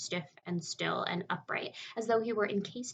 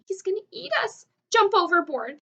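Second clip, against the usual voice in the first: high, loud frightened yelling; panicked shout; 10 semitones higher, 12 dB louder than usual. A narrator's reading. Ladybug yells to the others to abandon ship. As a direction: shriek the warning to jump.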